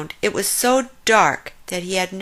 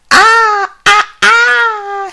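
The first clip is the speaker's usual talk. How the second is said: very high, loud screeching cries; 12 dB louder, much slower than usual. A narrator's reading. Glowing eyes overhead startled by the friends, screeching. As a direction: screech in alarm. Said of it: "screamed the eyes".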